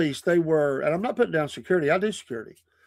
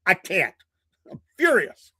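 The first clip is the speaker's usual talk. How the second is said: much higher, hammed-up mock fury; mock furious; 3 semitones higher than usual. Tells friend Jeff he can't let it go, hammed-up fury. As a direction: play up being furious in a comic whine. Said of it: "And I said".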